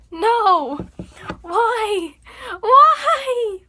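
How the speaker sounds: rising, loud cry half laughing; mock anguished cry. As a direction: ham up the heartbreak, voice climbing. Voice cracks mid-song, mock anguish.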